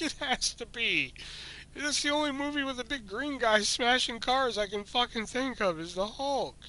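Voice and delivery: high, slowed insistence; pleading exasperation. Retold or imitated. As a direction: plead it has to be the Hulk. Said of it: "almost in fucking tears".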